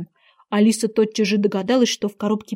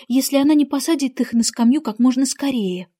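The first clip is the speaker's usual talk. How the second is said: usual speaking voice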